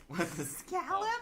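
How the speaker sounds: high, slow, disbelieving echo; incredulous squeal. Real, not acted.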